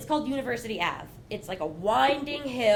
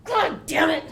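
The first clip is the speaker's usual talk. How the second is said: ordinary talk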